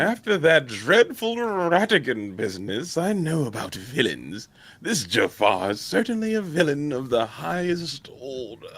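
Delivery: prim British detective, slow; lightly voiced British sleuth; a British gentleman's measured tone; British accent. Quoting Basil of Baker Street.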